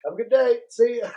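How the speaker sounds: light teasing goodbye; mock cheery farewell. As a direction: wave him off sarcastically. Mocking farewell to kicked-out guy.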